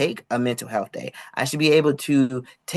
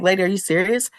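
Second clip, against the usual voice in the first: higher-pitched, incredulous retort; retold incredulity; 6 semitones higher than usual. Job applicant answering the dismissive lady, retold incredulity. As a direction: act out disbelief at the answer.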